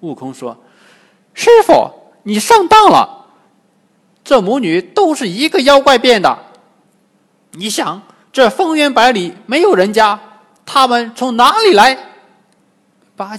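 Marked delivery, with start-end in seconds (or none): 1.4-11.9 very high, loud, excited character voice; urgent Monkey King. Acted. Wukong warns his master he was tricked, urgent and high. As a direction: protest at the top of your pitch, punching each phrase. 悟空急着向师傅辩解.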